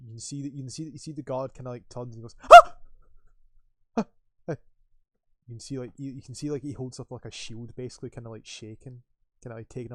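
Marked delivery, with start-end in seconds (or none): 2.5-4.6 short sharp gasping cries; startled yelp. Acted. Guard turns at a noise in the dark, startled yelps.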